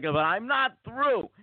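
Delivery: high, drawn-out mock protest; Gilbert Gottfried. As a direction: imitate a long-winded host refusing to stop. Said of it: "but then Gilbert's like".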